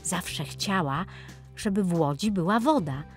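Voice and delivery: ordinary delivery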